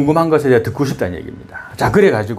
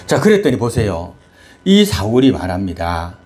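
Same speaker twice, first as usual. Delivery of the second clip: usual speaking voice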